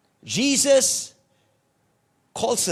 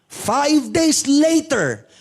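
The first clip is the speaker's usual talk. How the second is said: five days later, slow and louder, each word hit; dramatic emphasis; 5 semitones higher, 5 dB louder, much slower than usual. Shown as a demonstration. Preacher stressing five days later to congregation, slow and louder. Repeated phrase, dramatic slowdown.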